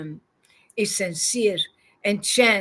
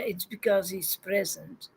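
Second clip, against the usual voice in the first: ordinary talk, nothing marked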